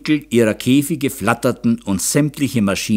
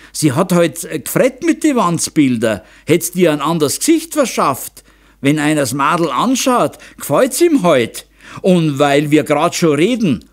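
lightly voiced indignant mother, raised pitch; scolding mother; 6 semitones higher, 4 dB louder than usual. A narrator's reading. Mother defends daughter to her husband, mildly scolding. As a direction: stand up for the girl, somewhat heated.

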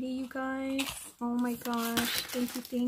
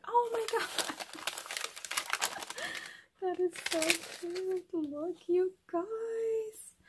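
thrilled squeal; 9 semitones higher than usual. Real, not acted. Thrilled by crocheted gift.

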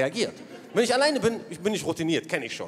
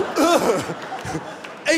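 a loud startled "Äh", higher; 5 semitones higher, 3 dB louder than usual. Retold or imitated. Son startled by father's words to police, shouting. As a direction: blurt it out in shock. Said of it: "Ich so".

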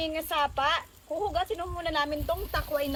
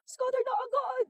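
excited gasp; 9 semitones higher than usual. Real, not acted. Slot win appears.

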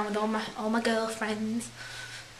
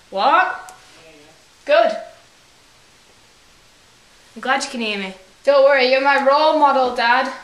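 loud, much higher calls; shouting; 3 semitones higher, 12 dB louder than usual. Real, not acted. Calling out loudly to Dad so he can hear. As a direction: shout it across the room so Dad hears.

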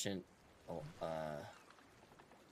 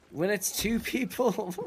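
laughing; 11 semitones higher, 6 dB louder, slower than usual. Real, not acted. Laughing while explaining.

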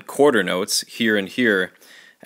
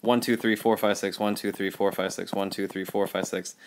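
rapid run of one to six, fading softer; fast eighth-note count; 3 semitones lower, 9 dB quieter than usual. Shown as a demonstration. Teacher shows students the too-fast metronome count, rushed. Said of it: "that's gonna be like this".

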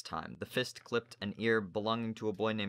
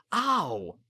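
pained yelp; 10 semitones higher, 8 dB louder than usual. Acted. Boy yelps when cuffed.

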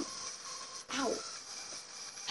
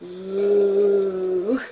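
ghost voice; 5 semitones lower than usual. Pretend. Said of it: "I make a good ghost impression".

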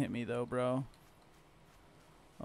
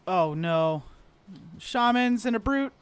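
scared horror game streamer; 7 semitones higher, 7 dB louder, slower than usual. Real, not acted. Enemies appear.

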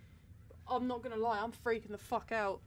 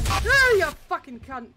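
a slow, much louder shocked scream of abuse; furious shout; 6 semitones higher, 20 dB louder, slower than usual. Real, not acted. Startled player screams abuse at the jump scare, shouting. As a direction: yell it at the thing that scared you.

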